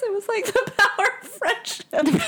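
tearful laugh. Real, not acted. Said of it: "Why am I crying?".

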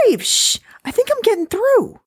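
put-on high, fussy older wife.

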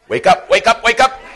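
loud, shouted "wake up"; mock road rage. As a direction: yell at the driver who cut you off. Road rage at other driver.

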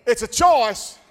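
shouted declaration. Acted. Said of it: "I said it's a choice".

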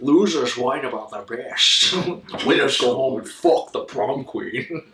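slow, put-on villain voice; quoting a movie villain. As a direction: drawl the movie line slowly for effect. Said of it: "Just thinking of The Rock quotes now".

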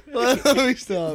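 drawn-out laughing plea; giggling through it. Real, not acted. Cracking up repeating line.

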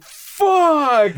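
sharp high yelled curse; pained yelp of a curse; retold yell. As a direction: cry out in sudden pain. Burned by ball, retold yell.

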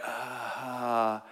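soft, drawn-out hesitant "uh"; reluctant stalling. Acted. Man asked to join a life-ending mission, reluctant stalling. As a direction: hesitate quietly, avoiding volunteering.

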